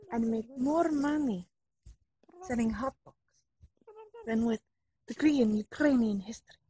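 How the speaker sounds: put-on exasperated son, Russian accent; fake Russian accent. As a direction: argue back in Russian accent. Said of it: "fake Russian spy".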